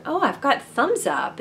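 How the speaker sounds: a lightly acted pleased surprise; surprised realization. Acting out her surprised discovery.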